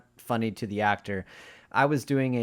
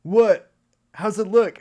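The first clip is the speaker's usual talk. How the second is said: loud, high, alarmed imitation; imitating a freaked-out actor, high and loud; panicked actor; 9 semitones higher, 9 dB louder, slower than usual. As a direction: blurt it out in retold panic. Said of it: "And the guy goes".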